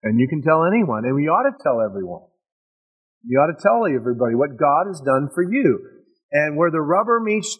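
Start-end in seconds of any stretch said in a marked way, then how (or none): none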